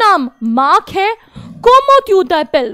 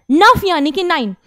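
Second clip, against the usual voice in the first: normal talking voice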